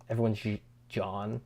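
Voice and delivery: ordinary talk, nothing marked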